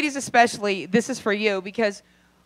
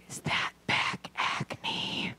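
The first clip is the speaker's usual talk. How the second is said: high, breathy, slow recoil; disgusted gasp; 12 semitones higher, 10 dB quieter, slower than usual. Retold or imitated. Spotting back acne on the partner, a high horrified whisper. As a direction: whisper it in horror, then squeak. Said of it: "you look over and you're like".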